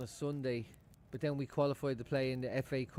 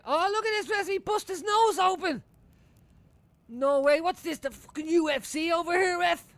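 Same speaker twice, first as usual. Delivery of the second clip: much higher, loud, outraged; outraged shout at ref; 10 dB louder than usual. Real, not acted. Fan yelling at the referee over a bloody nose, loud and outraged. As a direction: yell at the telly ref.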